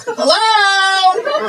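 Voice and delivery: very high, campy caricature. Acted. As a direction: squeal it in exaggerated camp.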